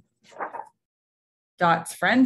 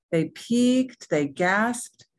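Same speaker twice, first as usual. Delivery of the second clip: usual speaking voice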